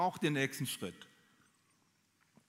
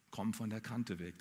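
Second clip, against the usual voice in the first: low, soft murmur; quiet echo of the warning; 6 semitones lower, 9 dB quieter than usual. Retold or imitated. The jumper recalls her mother's warning as a low, soft echo. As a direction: let the warning fade away, low and soft. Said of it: "Die rief mir ins Ohr".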